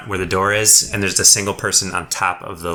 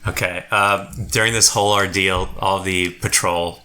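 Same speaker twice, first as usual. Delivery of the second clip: ordinary delivery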